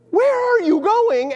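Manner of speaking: high, loud, drawn-out question; exasperated outburst. Acted. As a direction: demand it in mock exasperation. Acted exasperation at same unanswered problem.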